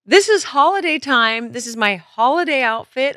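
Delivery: high, bright showing-off voice; excited. Real, not acted. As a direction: squeal it out. Showing off holiday outfit.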